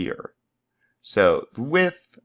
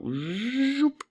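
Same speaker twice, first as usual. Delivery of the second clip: high rising cartoon zoop, softer; a quick whimsical whoosh noise; playful zoop; 4 dB quieter than usual. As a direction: swoop the word up like a toy rocket. Playful sound effect while scaling.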